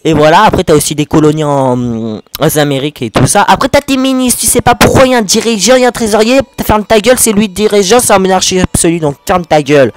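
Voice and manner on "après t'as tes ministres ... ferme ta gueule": higher, fast-rising rant about the ministers; a real burst of excitement, higher than usual; getting carried away. Real, not acted. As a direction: let yourself get worked up and ranty. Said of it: "Ah, je m'emballe un peu".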